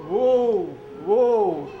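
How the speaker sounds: loud whistle call "woo, woo"; train whistle. Shown as a demonstration. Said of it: "Can you say that too".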